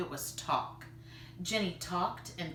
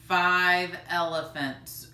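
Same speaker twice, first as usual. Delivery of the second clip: slow, louder drawn-out groan; 8 dB louder, slower than usual. Acted. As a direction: groan it with a heavy sigh of hunger. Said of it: "groaned grandfather".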